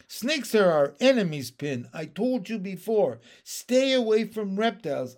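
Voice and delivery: loud, alarmed telling-off; exasperated scolding. Acted. Young frog loudly scolding Pin for leaping at the snake, exasperated. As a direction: scold the friend who nearly died.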